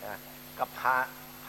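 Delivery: ordinary talk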